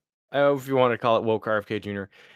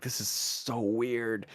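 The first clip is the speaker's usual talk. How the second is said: much softer, slow and baffled; muttered aside; 2 semitones lower, 11 dB quieter, slower than usual. Acted. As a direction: mumble it to yourself, weirded out. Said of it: "and she's like".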